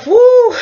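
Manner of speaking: loud, high "woo"; exclaimed whoop. Real, not acted. Reviewer reacting to the shoe-licking demand, loud high whoop. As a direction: let out a sharp, high hoot.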